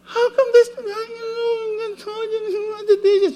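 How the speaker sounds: high, wailing wife complaining about the dishes; upset wife. Retold or imitated. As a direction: cry out like a hurt wife.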